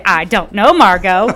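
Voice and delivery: "know" leaned on loudly in a playful quote; loud mock retort with "know" stressed. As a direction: punch "know" like a movie catchphrase. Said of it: "The emphasis on no".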